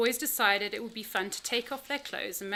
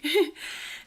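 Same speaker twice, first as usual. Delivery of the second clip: teary laugh; 6 semitones higher, 5 dB louder than usual. Real, not acted. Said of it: "Sorry, I'm sounding a bit teary".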